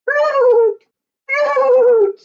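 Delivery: very high, loud piping flute call; a high tooting flute cry; flute sound imitation. Shown as a demonstration. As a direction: pipe it out like a flamingo's flute. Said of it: "fluting in my ear".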